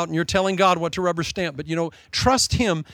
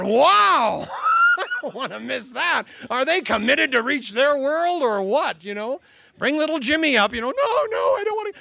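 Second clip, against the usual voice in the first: high laughing mock exclamation, much higher; laughing mock excitement; 7 semitones higher than usual. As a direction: poke fun while laughing, voice up high. Mocking exploding youth sign.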